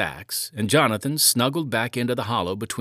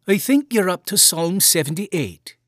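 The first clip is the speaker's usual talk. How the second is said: lightly voiced mother, much higher; a hint of a fond Irish mother; mother reassuring her son about the shirt; cheerful mother; 9 semitones higher, 5 dB louder than usual. A narrator's reading.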